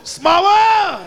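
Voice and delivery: put-on high, loud kid chant; wild kids chanting the ride song; kids yelling. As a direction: yell it like a rowdy kid. Kids chanting while attacking.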